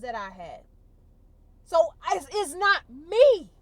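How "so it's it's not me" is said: louder, higher, slow cry of release; emotional realization. Real, not acted. Telling viewers the breakthrough, loud and slow realization. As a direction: let it burst out, slow and raw. Realizing she was never the problem.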